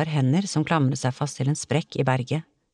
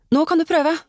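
high, loud call; urgent call; 11 semitones higher, 5 dB louder, faster than usual. A narrator's reading. Emma holding Olav round the waist, urgent call. Calling instruction during rescue.

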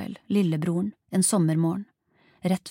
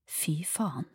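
hushed, whispered curse; stunned swearing in a whisper; 8 dB quieter than usual. A narrator's reading. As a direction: whisper it under your breath. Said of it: "hvisket Arias".

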